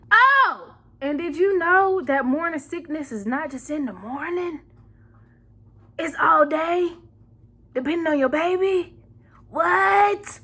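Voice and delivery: high, exaggerated shock at a revelation; scandalized, hammed-up surprise; mock astonishment. Acts out astonished discovery for viewers.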